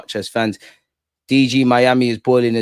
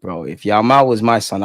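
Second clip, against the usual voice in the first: ordinary delivery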